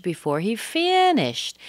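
FINISHED punched high in disbelief; can't-believe-it emphasis on "finished"; stress on FINISHED. Shown as a demonstration. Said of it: "you might even say finished".

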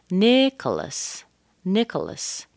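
NICH-olas, first syllable high, then falling; first-syllable stress. Shown as a demonstration. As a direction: stretch and lift the "Nich" in "Nicholas". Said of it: "but in this case".

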